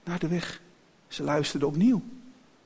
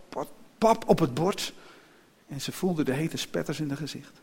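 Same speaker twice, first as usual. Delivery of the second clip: ordinary delivery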